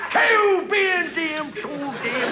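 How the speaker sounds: put-on high, slow, mushy old prospector mumble; cackling Gabby Hayes mumble, words all slurred; mumbling old-timer. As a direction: garble it like a toothless old miner. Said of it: "Nobody understood a word the man ever said".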